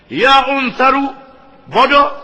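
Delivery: high, loud, slow angry rebuke; father berating his son, angry; harsh scolding. Retold or imitated. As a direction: scold the foolish son in full voice. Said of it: "Ini kasarnya bahasanya".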